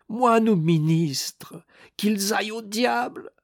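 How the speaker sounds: high, drawn-out exasperated cry; strained, high-pitched dismissal; angry curse. A narrator's reading. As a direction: curse them both, slow and high. Wounded man curses both clergymen.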